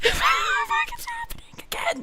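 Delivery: very high, slow, hammed-up sobbing voice; fake-cry it like a cartoon meltdown; mock wail. Over the endless compliments, mock sobbing wail.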